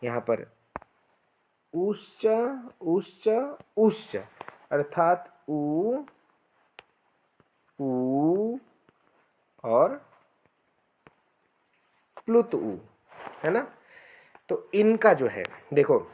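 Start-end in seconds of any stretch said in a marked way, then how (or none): 1.7-4.2 ऊश्च repeated short, long, then prolonged; three lengths of u. Shown as a demonstration. Teacher chanting the three vowel lengths. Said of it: "ये जो लिखे हैं". 4.7-12.8 ऊ stretched slower on each take; short, long, prolonged u. Shown as a demonstration. Teacher demonstrating vowel lengths slowly. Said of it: "तीन प्रकार के ऊ".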